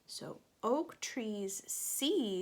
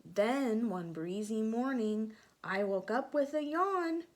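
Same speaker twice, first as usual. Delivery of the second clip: bright, lilting storybook narration, a bit louder; reading to children; 3 semitones higher, 6 dB louder than usual. A narrator's reading. Teacher reading a picture book to children, lilting storybook voice. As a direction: draw the little ones into the story.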